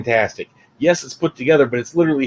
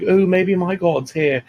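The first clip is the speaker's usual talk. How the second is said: higher, drawn-out wondering voice; slow, lilting "ooh" of naive hope; lightly voiced hopeful Korg; Korg's hopeful ooh; 6 semitones higher, slower than usual. Voicing Korg's hopeful line.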